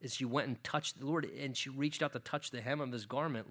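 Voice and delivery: normal talking voice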